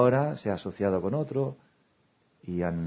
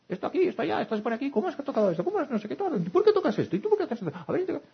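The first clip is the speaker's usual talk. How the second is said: much higher, rapid-fire nagging mother scolding; bossy mother; faster than usual. Retold or imitated. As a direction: nag like a mother storming through the house. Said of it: "Una madre puede arrasar en su casa". (madre agobiada regañando con voz mucho más aguda)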